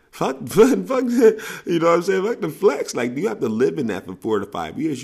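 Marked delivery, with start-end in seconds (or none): 0.1-3.0 high, loud laughing dismissal. Real, not acted. Laughing loudly as he tells recruits to forget flex money.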